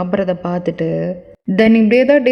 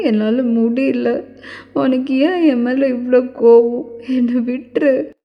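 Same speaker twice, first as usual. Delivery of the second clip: tearful sleep pleading; 2 semitones higher than usual. Acted. Woman begging to be left alone in her sleep, acted tearful pleading. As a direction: beg weakly as if crying in sleep. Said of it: "தூக்கத்துல இப்படி அழுகுற".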